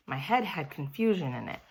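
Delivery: usual speaking voice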